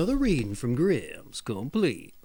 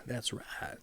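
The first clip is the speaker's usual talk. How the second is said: ordinary delivery, nothing marked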